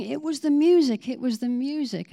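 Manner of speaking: high, loud excited exclaim; buzzing excitement. Retold or imitated. Nurses buzzing round the ward, loud and excited. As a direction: nurses thrilled by a miracle. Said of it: "nurses are buzzing around going".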